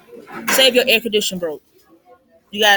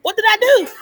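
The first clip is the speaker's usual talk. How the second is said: squeaky, much higher, louder cry of confusion; mock stunned disbelief; 12 semitones higher, 3 dB louder than usual. Just-fired worker asking the boss what happened, much higher and baffled. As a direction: throw the voice way up, baffled. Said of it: "you gonna look like".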